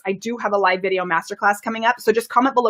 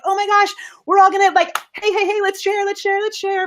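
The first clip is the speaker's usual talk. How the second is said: much higher, fast, excited rally cry; energizing hype; 9 semitones higher than usual. Shown as a demonstration. Coach demos rallying viewers to share, much higher and excited. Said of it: "My voice is gonna go up".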